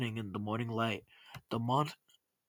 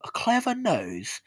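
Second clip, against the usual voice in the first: high, louder mocking echo; mother throwing it back in disbelief; incredulous retort; 9 semitones higher, 5 dB louder than usual. A narrator's reading. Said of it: "his mother hissed".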